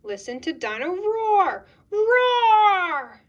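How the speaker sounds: loud, high toy voice into a roar; chanted slogan, then long loud roar; roaring toy dinosaur. Acted. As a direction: burst to life with a huge roar. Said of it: "Dino Roar comes to life".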